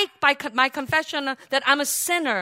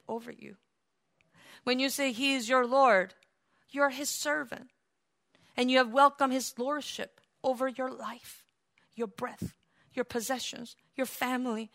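soft reverent preaching; 5 dB quieter than usual. Real, not acted. Preacher explaining what calling him Lord means, hushed reverence. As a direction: set each phrase down softly with pauses.

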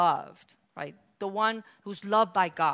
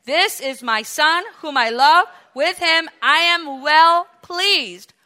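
emphatic voice of God; 6 semitones higher, 10 dB louder, slower than usual. Acted. Quoted again, more emphatic.

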